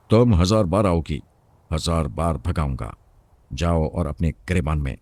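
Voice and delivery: usual speaking voice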